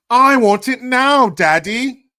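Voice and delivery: high, loud, slow bratty demand; spoiled brat. Acted. Spoiled Veruca Salt kid demanding from daddy, bratty whine. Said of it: "Every kid is Veruca Salt".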